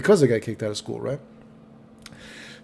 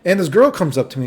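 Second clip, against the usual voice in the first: ordinary delivery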